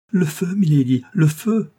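high, loud cry of fire; urgent and frightened; alarmed cry. A narrator's reading. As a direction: raise the alarm, pitch climbing.

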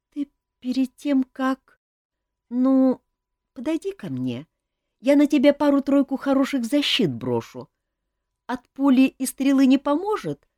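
put-on high-pitched boy, halting at first; falter, then offer help in a high voice; hesitant boy. A narrator's reading. Young prince offering the king magic protection, hesitant then eager. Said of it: "помаявшись, добавил".

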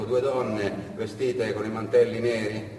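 ordinary delivery

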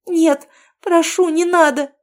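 high, loud, slow terrified begging; pleading. A narrator's reading. Girl begs Aino not to burn her, terrified. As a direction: beg in panic for it to stop. Hot stone near her face.

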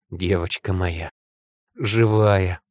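slow, low tender murmur; breathed-out relief. A narrator's reading. Father embracing daughter found alive, tender relief. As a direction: exhale the words tenderly, low. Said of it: "выдохнул в макушку отец".

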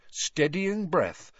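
ordinary delivery

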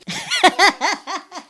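high amused laugh. Real, not acted. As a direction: burst out laughing. Amused by the elf's answer.

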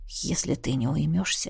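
low, soft warning; menacing undertone; quiet threat. A narrator's reading. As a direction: drop the voice to warn. Dragon threatens the chattering gnome.

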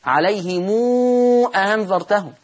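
عليهم أنذرتهم with a long 'moo' linking عليهم to the hamza; recited with silah. Shown as a demonstration. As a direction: draw out عليهم into 'alayhimoooo', then a clear hamza on أنذرتهم. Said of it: "هعمل لها صلة".

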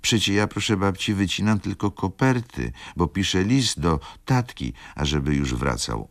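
lightly voiced small girl, innocent; little girl. A narrator's reading. Girl telling grandma about her letter, light child voice. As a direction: pipe up like a child defending herself. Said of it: "odezwała się dziewczynka".

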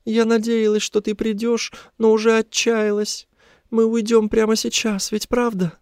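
very high, pleading female voice; high-pitched tearful captive; tearful woman. A narrator's reading. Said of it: "заплакала она".

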